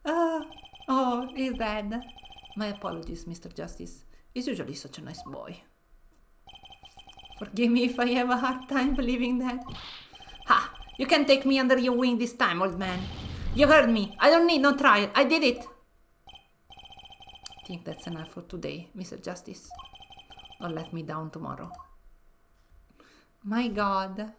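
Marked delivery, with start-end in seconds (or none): 2.6-5.6 low, soft gangster father; much lower, quieter boss voice; mob boss voice. Acted. 10.5-15.7 loud defiant shout; louder confession, thrown at his father; defiant punk. Acted. Son defying his father. 16.3-21.7 much lower, hushed gangster threat; mob boss voice. Acted. Boss dismissing the lawyer.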